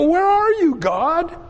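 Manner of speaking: high, loud cry of where are you; desperate plea to God. Retold or imitated. Believer facing daily struggles cries out, high and loud. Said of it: "and we go".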